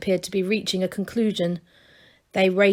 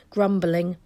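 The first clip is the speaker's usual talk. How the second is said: ordinary delivery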